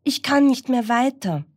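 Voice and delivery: high, tired plea; a worn-out swimming dog begging; gasping dog. Acted. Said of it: "japste der Hund".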